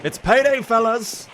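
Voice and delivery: loud, high rallying call; Payday catchphrase. Acted. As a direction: belt it out to the crew like a game character. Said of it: "can you do a line from Payday two".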